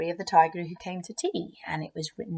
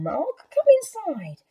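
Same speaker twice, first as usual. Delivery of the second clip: high coaxing; 14 semitones higher, 5 dB louder, slower than usual. Acted. Child coaxes cat.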